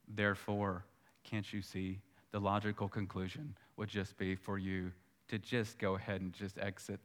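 a hint of a low, soft tempter murmuring despair; lightly voiced accuser, coaxing and quiet; tempter's voice. Preacher voicing the tempter to the listener, low and soft.

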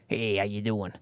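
a quick tough-guy mobster voice, half-hearted; mobster impression. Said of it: "Like the mobster movies".

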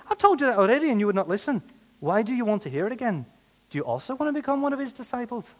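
cheeky high-pitched Irish retort; Irish accent. Acted. Healed man answers his interrogators, high Irish accent. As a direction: answer back in a hammed-up Irish lilt. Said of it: "That's where you need the Irish accent".

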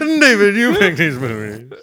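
loud, high showman's call; mock announcer. Cohost loudly parodying the transition to David, game show boom. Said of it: "I should have been a game show host".

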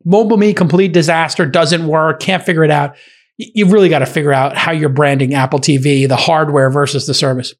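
ordinary delivery

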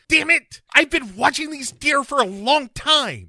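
high, loud, put-on outraged naturalist; shrieking squeaky voice, furious at the phone; silly high cranky scientist; Scotty voice. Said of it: "he's just like".

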